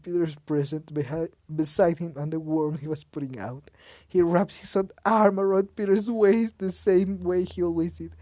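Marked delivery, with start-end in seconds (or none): none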